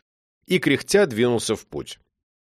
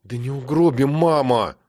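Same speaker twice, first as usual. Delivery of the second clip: sighing. A narrator's reading. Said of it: "отвечала тётя Валя, вздыхая".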